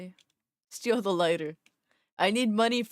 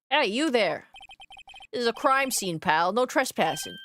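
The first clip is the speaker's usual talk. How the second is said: attempted Jersey accent; 3 semitones higher than usual. Detective warning off a trespasser at a crime scene, Jersey accent. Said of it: "He's gonna be fucking Jersey".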